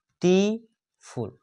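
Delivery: three separate beats on beautiful; split into syllables. Shown as a demonstration. Teacher counting syllables for students, beautiful split into three sounds. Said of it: "इसमें तीन sound उत्पन्न होता है".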